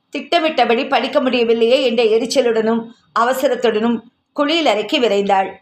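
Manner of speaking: ordinary delivery, nothing marked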